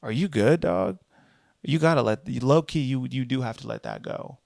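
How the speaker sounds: normal talking voice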